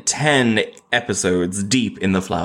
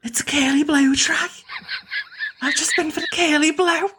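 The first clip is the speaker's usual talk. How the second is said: much higher, hammed-up Scouse; Scouse accent. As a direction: squeal it in an over-the-top Liverpool voice. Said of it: "do you know what a curly blow is".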